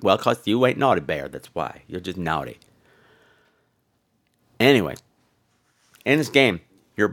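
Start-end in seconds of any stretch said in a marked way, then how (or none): 0.0-2.6 low, softening deadpan comeback; dry retort. Retold or imitated. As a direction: answer back low and smug, trailing softer. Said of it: "and I'm just like".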